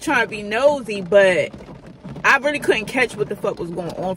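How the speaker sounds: ordinary talk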